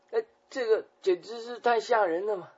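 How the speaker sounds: high, loud cry of alarm; shocked exclamation. Real, not acted. Preacher reacts to the accusation with loud shock. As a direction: gasp at how outrageous it is.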